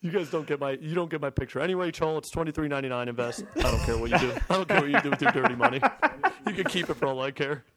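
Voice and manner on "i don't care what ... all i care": mock outrage. Mock indignant outburst.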